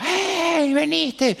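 high, glad call from a distance; mother calling out to her son as he arrives; calling out happily. Retold or imitated. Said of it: "Y me gritaba". (llamada alegre y aguda desde lejos)

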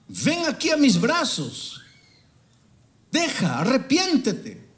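high, slow, tender plea; tender divine call. Acted. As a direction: call them gently into open arms. God's voice calling sinner home. (llamado tierno y lento, en voz alta y aguda)

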